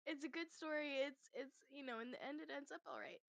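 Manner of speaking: high, tearful, about to cry; holding back tears. Real, not acted. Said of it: "You're gonna make me cry".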